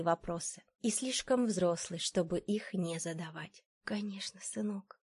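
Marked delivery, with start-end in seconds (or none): 3.8-5.0 soft reassuring whisper. A narrator's reading. Mother holding her little son close, whispering. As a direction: comfort him under your breath. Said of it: "прошептала я".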